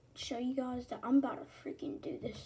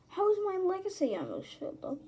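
high, loud disbelief; incredulous whine; 8 semitones higher, 4 dB louder than usual. Real, not acted. Baffled by how fast the game fills, high incredulous whine. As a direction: pitch the complaint way up. Complaining about game progress.

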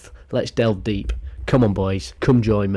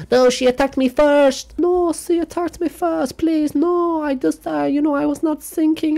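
much higher pleading voice; mock husband pleading. Husband pleading self-defense in court, high mock pleading. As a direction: beg like the guilty husband. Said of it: "So he then played".

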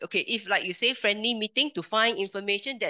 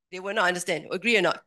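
ordinary talk, nothing marked

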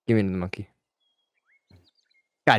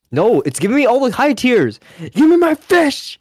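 frustrated yell; 10 semitones higher, 6 dB louder than usual. Real, not acted. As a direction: yell at the game for fish. Frustrated gamer yelling at game.

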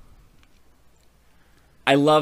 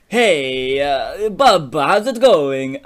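awkward greeting; 6 dB louder, much slower than usual. Acted. Imp greets Bubba awkwardly, played comically.

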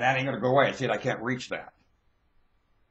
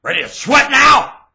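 high, loud demand; high-pitched yell; shouted challenge; 12 dB louder than usual. Real, not acted. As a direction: challenge them at full volume.

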